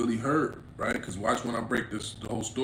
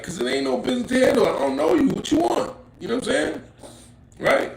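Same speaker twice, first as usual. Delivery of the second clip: much higher, louder, fast worked-up rant; heated; 12 semitones higher, 7 dB louder than usual. Real, not acted. Complaining to viewers about his homie, worked-up loud rant. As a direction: fire it off, fed up and riled.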